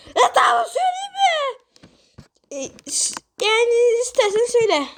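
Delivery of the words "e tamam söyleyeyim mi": a piercing high yell of a little singer; loud high greeting. Acted. Pumpkin yells his greeting into the judge's ear, loud and high. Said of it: "başım şişti ya".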